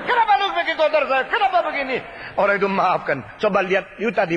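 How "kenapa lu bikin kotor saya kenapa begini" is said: high indignant questioning; angry owner confronting the painter; angry demand. A narrator's reading. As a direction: demand an answer, outraged. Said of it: "Dia marah luar biasa".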